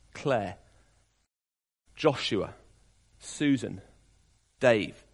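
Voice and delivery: low, softly spoken pastoral reassurance; hushed, personal assurance. Real, not acted. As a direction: let each phrase land gently, one at a time. Preacher personalising the verse for listeners.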